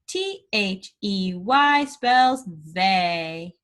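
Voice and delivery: letters spelt out slowly; slow spelling. Shown as a demonstration. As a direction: spell each letter with a pause. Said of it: "spell it".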